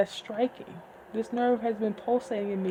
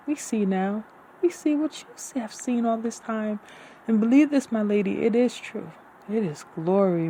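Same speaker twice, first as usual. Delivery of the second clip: grand fantasy narration; dramatic story reading; 6 dB louder than usual. Acted. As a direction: read it like an epic, full voice. Continues dramatic book reading.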